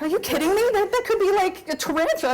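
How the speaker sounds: high, alarmed disbelief; incredulous fright. Retold or imitated. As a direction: protest that it could be a tarantula. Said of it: "It's like".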